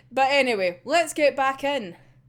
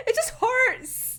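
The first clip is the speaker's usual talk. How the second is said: high, slow, half-crying whine; slow whimpering laugh-cry; pained whimper; 12 semitones higher, much slower than usual. Real, not acted. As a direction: whine that it hurts. Pained by Kunikida's scream.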